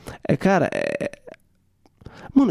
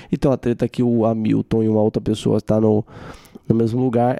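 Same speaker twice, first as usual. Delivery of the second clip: ordinary talk, nothing marked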